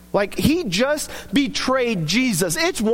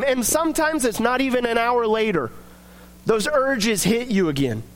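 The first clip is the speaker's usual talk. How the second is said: ordinary talk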